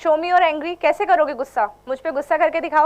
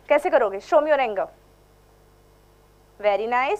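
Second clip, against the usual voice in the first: usual speaking voice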